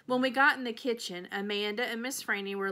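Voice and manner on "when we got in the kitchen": ordinary delivery, nothing marked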